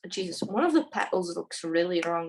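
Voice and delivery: ordinary talk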